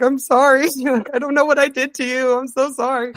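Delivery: high, laughing, flustered sorry; flustered laughing apology. Retold or imitated. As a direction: apologize through nervous laughter, voice jumping high. Apologizing to crying fan.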